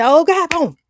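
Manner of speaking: high alarmed cry at impact; panicked cry. Retold or imitated. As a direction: relive the crash as it lands. Retelling the moment of impact.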